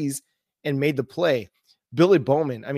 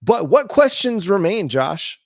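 ordinary talk, nothing marked

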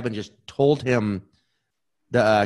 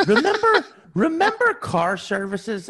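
excited; 12 semitones higher than usual. Real, not acted. Excited nostalgic outburst.

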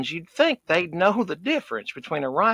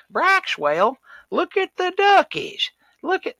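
put-on much higher, cooing baby talk to a toddler; high sing-song mom voice; mocking the hipster mom; 3 dB louder, slower than usual. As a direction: gush over the duckies like a doting mom. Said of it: "the mama kept saying".

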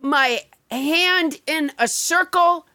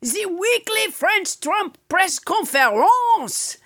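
a lightly put-on French accent as Trump; hammed-up French-accented Trump bit; French Trump impression. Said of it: "it is a French President Trump".

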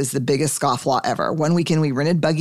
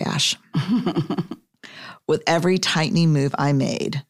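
usual speaking voice

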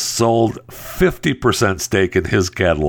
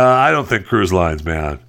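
normal talking voice

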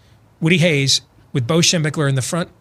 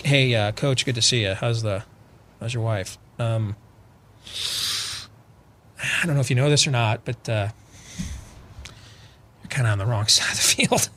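softer, halting, scared to speak up; nervous mumble; 7 semitones lower, 6 dB quieter than usual. Retold or imitated. Bo nervously approaching his old coach, a soft timid mumble. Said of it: "He's about to crap a brick right there".

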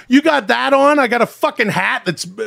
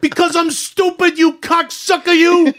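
higher, heated tough-guy threats; ranting character voice; 6 semitones higher than usual. Acted. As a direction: threaten him like a mobster in a tirade.

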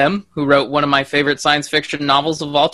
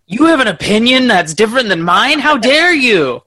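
hammed-up indignation, rising higher and slowing at the end; mock outrage; 4 semitones higher, 3 dB louder than usual. Host mocks intolerance of other opinions, theatrical outrage. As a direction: play scandalized, drawing out the final words.

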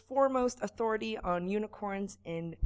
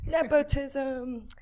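drawn out; 6 semitones higher, much slower than usual. Acted. Joke correction.

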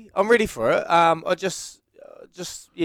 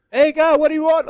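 high put-on voice of the loader driver, nothing like his own; 14 semitones higher, 4 dB louder than usual. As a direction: play the gruff worker answering from up high. Voicing loader driver in story.

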